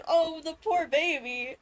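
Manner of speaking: normal talking voice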